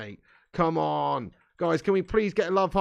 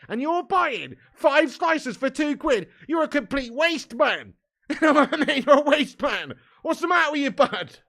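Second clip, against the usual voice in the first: higher and louder, teasing mock outrage; mock outraged rant; 6 semitones higher, 5 dB louder than usual. Real, not acted. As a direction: rip into the viewer with playful exasperation. Mock outrage at viewer's watermelon.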